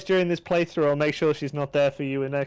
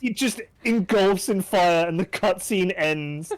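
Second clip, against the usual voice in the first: excited; 9 semitones higher than usual. Real, not acted. Describing cutscene excitedly.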